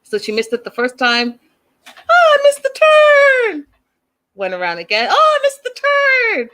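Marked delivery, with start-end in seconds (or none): 1.8-3.7 very high, loud, drawn-out cry; hammed-up high-pitched wail of a flustered driver; mock wail. Acting out driver missing turn. 5.1-6.5 hammed-up high-pitched wail of a flustered driver; mock wail. Same missed turn, second time.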